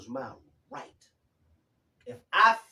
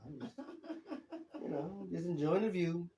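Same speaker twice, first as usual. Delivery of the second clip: laughing; 11 dB quieter than usual. Real, not acted. Laughing at her own flirty remark.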